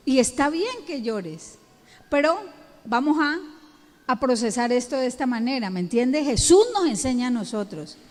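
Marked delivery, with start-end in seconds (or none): none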